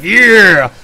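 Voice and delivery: loud, high whoop of recognition; retold burst of delight; excited cheer. Retold delight at hearing the song.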